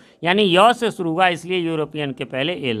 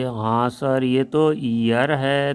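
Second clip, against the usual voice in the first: low, drawn-out student reply; imitated student answering; 4 semitones lower, much slower than usual. Student answering the coaching teacher's question, hesitant imitated reply. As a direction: play a pupil slowly sounding out the word. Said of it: "तो कहते थे".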